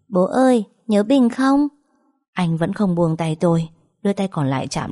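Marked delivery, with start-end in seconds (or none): 0.1-1.7 put-on high-pitched small child, eager question; delighted little boy. A narrator's reading. Little boy asking his newly awakened dad, delighted child voice. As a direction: pipe up like a delighted toddler. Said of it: "thằng bé thấy bố tỉnh thì thích thú hỏi".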